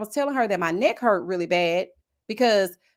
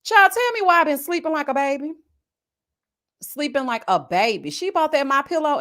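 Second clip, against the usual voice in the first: normal talking voice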